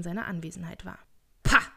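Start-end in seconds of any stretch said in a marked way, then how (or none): 1.4-1.7 loud, abrupt dismissive burst; one explosive grumpy interjection; scornful exclamation. A narrator's reading. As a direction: spit it out loudly in contempt. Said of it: "rief Scrooge".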